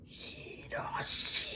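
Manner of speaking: slow, ghostly whispered name; eerie drawn-out whisper. Retold or imitated. Ghost woman calling the name.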